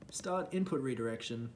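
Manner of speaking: ordinary delivery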